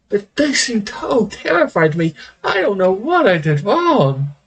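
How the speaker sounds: mock-hurt villain. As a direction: whine it like a wounded diva. GM voicing mock-hurt lich.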